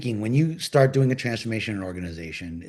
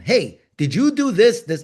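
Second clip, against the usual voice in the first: higher, louder, slow accusing demand; a hammed-up angry coworker pressing him; 7 semitones higher, 5 dB louder than usual. Coworker demands an answer at the impediments board, angry and loud. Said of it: "like, an angry tone".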